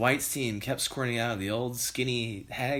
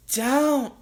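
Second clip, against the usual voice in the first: Missus Cranky crying out; 13 semitones higher, 6 dB louder than usual. A narrator's reading. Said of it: "cried Missus Cranky".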